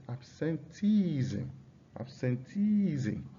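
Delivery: "Absenteeism" slowly with TEE stretched long; stress on second-last syllable. Shown as a demonstration. Teacher showing a variation in stress, long TEE in "Absenteeism". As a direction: hold the TEE in "Absenteeism", then drop the ending. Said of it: "on the second to the last syllable".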